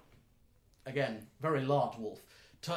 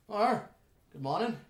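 higher, louder greeting; raised elf character voice; elven stranger; 8 semitones higher, 7 dB louder than usual. Acted. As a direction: hail walkers as a friendly elf. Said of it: "this elven individual ... and goes, like".

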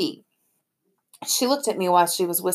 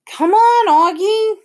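eager urging; 13 semitones higher, 8 dB louder, slower than usual. Acted. Sister urging him to open box.

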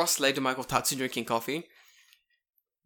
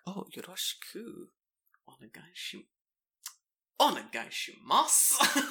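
higher, slowed, drawn-out flustered reply; smitten greeting; 6 semitones higher, slower than usual. Retold or imitated. Greeting the cute delivery girl, flustered and smitten. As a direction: melt a little as you greet her. She's a fucking cutie.

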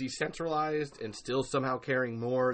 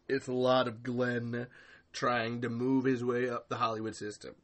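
ordinary talk, nothing marked

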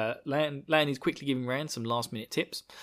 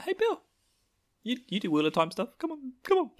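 high, pleading mock voice; squeaky excited imitation; imitating a fan host; 12 semitones higher than usual. Fan host begging Bill to come on his show, high mock voice. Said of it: "Be like".

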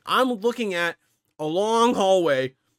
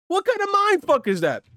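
much higher incredulous cry; 14 semitones higher than usual. Real, not acted. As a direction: disbelief pushed even higher. Repeats outraged question.

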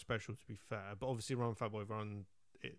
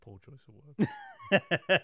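wry aside; 5 semitones higher, 8 dB louder, much slower than usual. Real, not acted. Wry aside after pun.

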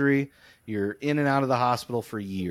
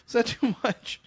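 much higher, teasing mock-shy repeat; playful sheepish; 10 semitones higher than usual. Real, not acted. Repeating the question to his cohost, higher and playfully coy. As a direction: ask it again, higher and coy. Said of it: "I just had to let that out".

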